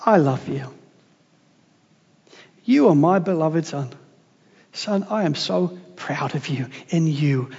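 low, soft and tender, as God to his Son; tender father. Acted. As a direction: speak gently like a loving father. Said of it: "What a tender moment".

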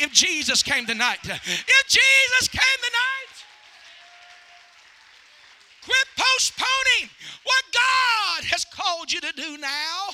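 loud, fervent revival preaching; preaching at full force. Acted. Evangelist urging the congregation to repent, preaching loudly. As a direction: drive the altar call home.

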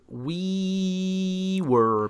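every word stretched very slowly; drawn-out emphasis. Real, not acted. Telling a friend how drunk they got, hugely drawn out. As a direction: savour it, dragging out each sound.

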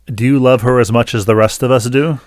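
loud, mock-accusing challenge; imitated accusatory question. Lady challenges husband's love for wife, imitated accusatory. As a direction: quote her as if cross-examining.

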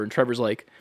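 usual speaking voice

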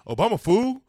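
high, loud cocky retort; imitated pool-hall dude. Retelling one friend answering another on voting, loud mock retort. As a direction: play the buddy giving an obvious answer. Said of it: "He was like".

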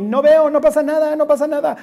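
sing-song mock of someone ignoring trouble; mock denial. Preacher mocking churchgoers who ignore their problems, airy mock denial.

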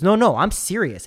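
retold insistence. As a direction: play the stranger raising his voice, dead serious.

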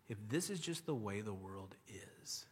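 hushed whispered question. Shown as a demonstration. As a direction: drop to a whisper to make it sink in. Repeats the view as a pointed question.

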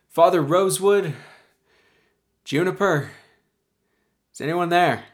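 loud, high call across the distance. Acted. Man calls out for Father Rosewood and Juniper, calling out. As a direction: shout out searching for someone. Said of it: "the man calls out".

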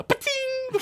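high-pitched "ba-ting" sound effect; bullet ricochet. Acted. Narrator voices a bullet bouncing off gold, high ringing ricochet.

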